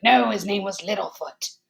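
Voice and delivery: mock-outraged loud correction; indignant killer. Killer corrects a wrong quiz answer, loud indignation. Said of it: "And he's like".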